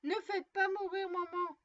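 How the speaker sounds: put-on startled child's scream of fear; frightened cry. A narrator's reading. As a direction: cry out in terror for mother. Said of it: "s'écria".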